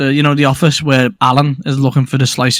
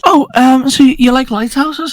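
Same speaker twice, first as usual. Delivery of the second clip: higher-pitched hesitant character; tentative question; 7 semitones higher than usual. Acted. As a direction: ask it lightly in a raised voice.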